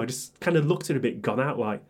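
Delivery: ordinary delivery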